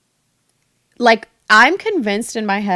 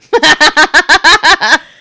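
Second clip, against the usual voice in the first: high, loud burst of laughter; real rapid-fire cackling laugh; 7 semitones higher, 11 dB louder than usual. Real, not acted. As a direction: crack up at your own memory. Laughing at her own memory.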